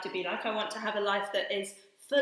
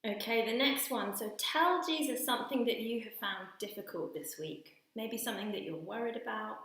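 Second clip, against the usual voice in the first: guided prayer; 3 semitones lower, 5 dB quieter than usual. Acted. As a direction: gently lead a quiet prayer. Leads children in guided prayer.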